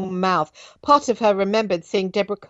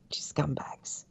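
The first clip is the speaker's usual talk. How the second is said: voiceless, much softer; whispered; 3 semitones lower, 14 dB quieter, slower than usual. Real, not acted. Reader mutters about the adoptive father in a whisper. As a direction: spit it out under the breath. Bitter aside at line end.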